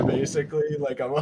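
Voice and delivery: usual speaking voice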